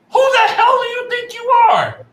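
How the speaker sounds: furious shout. Real, not acted. Fan yelling at Cody Rhodes, furious shout. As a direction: scream it at him in outrage.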